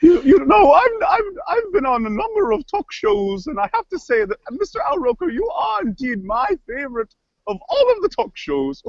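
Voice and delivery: halting, theatrical gushing; affected voice with swooping pitch; kooky cook character. Acted. Cook flatters the weatherman, kooky voice. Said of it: "crazy voices".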